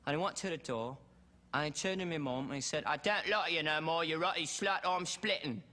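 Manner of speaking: put-on working-class English accent, matter-of-fact storytelling; English character accent. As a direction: play an English rocker with a regional British accent. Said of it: "he talks like this".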